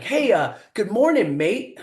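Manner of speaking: high, cheery mock greeting; chipper and cheery. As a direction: imitate a breezy, chipper British captain. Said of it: "He's all chipper and cheery".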